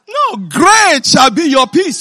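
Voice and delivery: slow, loud booming answer; proclaimed shout. Acted. Preacher answers the sleepless man, shouting peace. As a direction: declare it with full force. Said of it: "he said".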